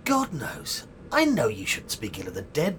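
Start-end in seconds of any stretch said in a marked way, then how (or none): none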